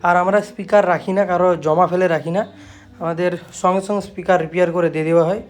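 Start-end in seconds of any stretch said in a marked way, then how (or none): none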